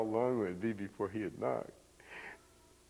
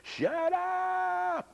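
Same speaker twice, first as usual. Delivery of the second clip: piercingly high, drawn-out screech; hammed-up screaming schoolteacher; nun's yell; 4 dB louder than usual. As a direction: shriek it long to silence a classroom. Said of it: "she would yell a lot".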